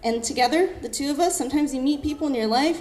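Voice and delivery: usual speaking voice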